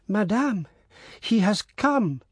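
high, slow joyful announcement; excited cry. A narrator's reading. Old servant rushing in to tell her mistress, high and joyous. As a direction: burst in with the happy news, pitched high. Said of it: "cried Brigitte".

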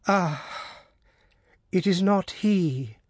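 high, slow horrified cry; terrified cry. A narrator's reading. Mother realising the stranger is not her son, high and terrified. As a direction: recoil in terror, voice pitched high. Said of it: "she cried, recoiling in terror".

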